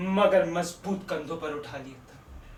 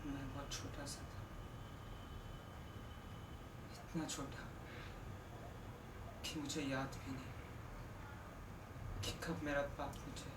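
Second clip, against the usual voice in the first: much softer, lower, almost to himself; hushed memory; 4 semitones lower, 18 dB quieter than usual. Acted. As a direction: confess it quietly, like a painful memory. Sad monologue about lost father. (धीमी, उदास आवाज़ में बचपन की याद)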